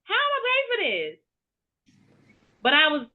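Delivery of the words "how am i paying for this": much higher, retelling her own panic; hammed-up alarm at the bill; panicked disbelief. Mother panicking over the All-Star costs, much higher. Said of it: "and I'm like".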